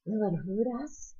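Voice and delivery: soft, hushed question; whispered. A narrator's reading. Peter asks Cole about the bear, whispering. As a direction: ask it under your breath. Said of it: "he whispered".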